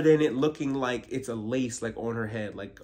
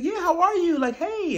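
very high, louder cheery hello; mock sugary greeting; 5 dB louder than usual. Mocking Wendy greeting again, very high. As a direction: chirp it sweetly.

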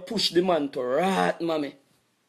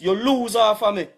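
normal talking voice

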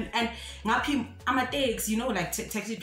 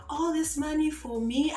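higher-pitched playful question; imitated Nigerian man; 7 semitones higher than usual. Taxi passenger cheering up crying girl, mock-delighted Nigerian voice. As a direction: mimic a stranger feigning delight to cheer someone up. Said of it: "judging from his accent".

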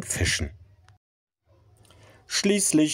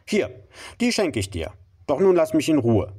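grudging; 8 semitones higher than usual. Acted. Grudging gift.

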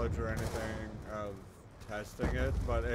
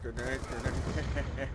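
slow drawn-out grenade, grenade; grenade warning yell; slower than usual. Real, not acted. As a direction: stretch each grenade slowly.

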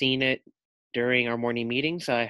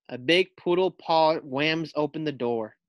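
plain reading voice

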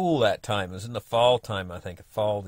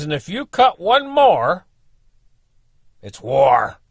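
slow, loud, higher threat ending on a firm war; angry threat; 7 semitones higher, 11 dB louder, slower than usual. Retold or imitated. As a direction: draw the line slowly and loudly. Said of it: "That's what he said. Quite literally".

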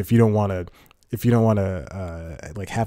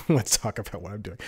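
softer, settled repeat after a laugh; amused self-echo; 7 dB quieter than usual. Real, not acted. Vlogger repeating his own announcement, quiet amused echo. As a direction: drop the volume, amused at yourself.

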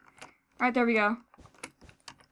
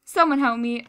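normal talking voice

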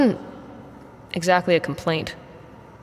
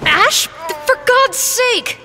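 startled shout; 13 semitones higher, much slower than usual. Acted. Woman startled in the dark hangar, crying out in shock. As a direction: jump out of your skin and yell it.

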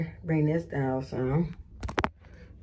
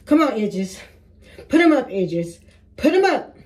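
louder and higher, pleading with the hair; pleading urging; 5 semitones higher, 5 dB louder than usual. Real, not acted. Coaxing her edges to stay up, loud and high. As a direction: beg it to cooperate, rising.

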